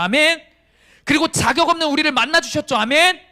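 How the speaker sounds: loud, high calls building to amen; rally the congregation for an amen; rousing preaching. Real, not acted. Preacher proclaiming forgiveness to the congregation, rousing full-force preaching.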